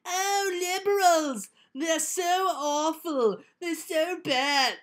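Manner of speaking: high, slow hammy villain voice; mustache twirling villain. Retold or imitated. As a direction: ham up a sneering cartoon villain. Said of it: "he sounds like an evil, mustache-twirling villain".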